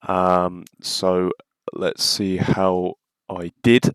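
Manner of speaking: ordinary talk, nothing marked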